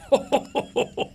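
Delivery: doctor laughing at the bluff; delighted laugh. Acted. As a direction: burst of high, surprised laughter.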